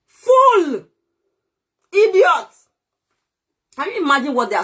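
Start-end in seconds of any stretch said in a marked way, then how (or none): 0.1-2.5 scorning critics, shouted insult. Real, not acted. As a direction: spit it out at full volume. Insulting critics, low then loud.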